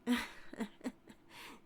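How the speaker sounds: airy, amused little laugh; teasing breathy chuckle. Acted. Teasing sheriff.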